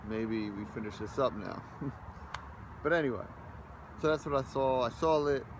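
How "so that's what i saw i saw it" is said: usual speaking voice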